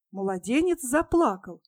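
plain reading voice

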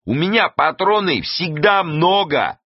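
high, stretched-out yell; shouting over noise. A narrator's reading. Гасым answers over the engine noise, yelling back. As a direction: holler it slowly over the din. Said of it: "переорать шум было непросто".

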